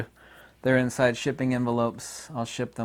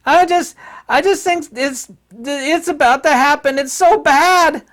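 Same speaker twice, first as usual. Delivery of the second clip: high, loud mock panic, much higher; mocking fearful woman; 10 dB louder than usual. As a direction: ham up a frightened official crying doom. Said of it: "She's like".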